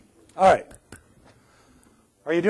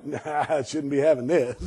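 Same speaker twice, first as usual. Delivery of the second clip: quiet, lower, guilty mumble at the buffet; mock guilty hesitation; 6 semitones lower, 4 dB quieter than usual. Buffet diner mock-scolding himself, quietly guilty. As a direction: talk yourself out of it half-heartedly, softly. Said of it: "you're going".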